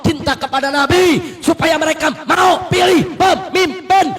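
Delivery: fiery preaching. Acted. Preacher rousing the crowd to unite, fiery shouted preaching. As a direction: whip the crowd up, word by word.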